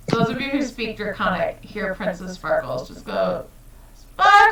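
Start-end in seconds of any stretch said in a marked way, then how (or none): 3.9-4.5 very high, loud, stretched-out squeal; piercing drawn-out creature call; dragon cry. Acted. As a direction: sing it out like a little creature. Dragon cries out its name.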